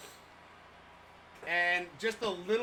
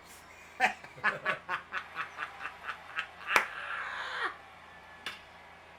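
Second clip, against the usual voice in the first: laughing. Real, not acted. Laughing at chat message.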